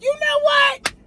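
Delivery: very high, loud exclamation; defiant squeal. Retold or imitated. Answering the song on the speakers, very high and defiant. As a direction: burst out with sudden resolve.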